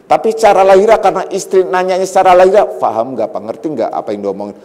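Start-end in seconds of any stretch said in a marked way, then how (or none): 2.7-4.6 fast, much softer wife's question; wife quietly asks her husband if he understood; hushed quick question. Retold or imitated. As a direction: rush it under your breath.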